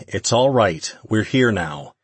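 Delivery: usual speaking voice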